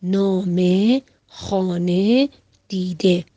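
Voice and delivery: نامه, خانه and دیده slowed way down, with a drawn-out final vowel; teacher modelling نامه خانه دیده slowly; slow vowel demo. Shown as a demonstration. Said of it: "و صدای مصوت ا". (تلفظ بسیار آهسته «نامه، خانه، دیده»)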